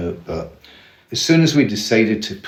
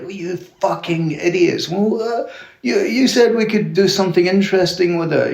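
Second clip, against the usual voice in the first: mock outrage; 10 semitones higher than usual. Outraged dad scolding sons over the money, imitated. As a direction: act out an outraged dad, pitch jumping right up. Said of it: "he was outraged".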